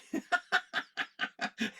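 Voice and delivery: high breathy burst of laughter; real laugh at his own joke; amused high laugh. Real, not acted.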